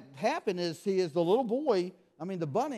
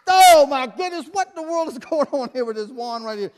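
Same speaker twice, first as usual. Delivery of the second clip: hammed-up shock; 7 semitones higher, 11 dB louder than usual. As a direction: act flabbergasted for the kids. Spots back again, feigned shock.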